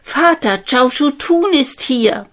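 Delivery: high, loud call to her father; excited princess calling father. Acted. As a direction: call across the palace. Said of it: "lief sie zum Schloss und rief".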